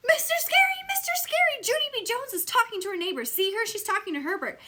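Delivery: high, loud whiny tattling; tattletale. Acted. May tells on Junie B. to Mister Scary, high loud tattling. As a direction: tattle shrilly to the teacher.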